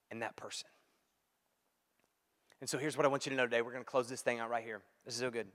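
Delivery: ordinary talk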